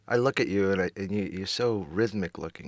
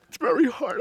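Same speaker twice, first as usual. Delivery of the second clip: cracking into a sob, higher; crying; 7 dB louder than usual. Acted. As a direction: voice breaks high into tears. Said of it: "I promised myself I wasn't gonna cry today".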